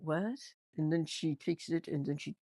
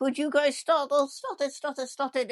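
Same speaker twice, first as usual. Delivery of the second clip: Larry; 6 semitones higher, 4 dB louder than usual. Retold or imitated. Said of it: "Larry's like".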